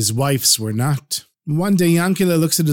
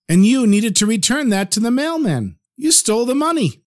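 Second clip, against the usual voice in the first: accusing wife; 7 semitones higher than usual. Acted.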